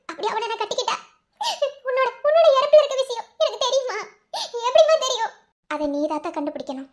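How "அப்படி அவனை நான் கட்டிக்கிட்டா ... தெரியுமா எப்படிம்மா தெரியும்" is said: rising to a loud, high sobbing wail; crying. Acted. Daughter to her mother in a dream, sobbing louder and higher. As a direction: daughter breaks down asking her dead mother how she could know.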